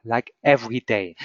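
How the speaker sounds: usual speaking voice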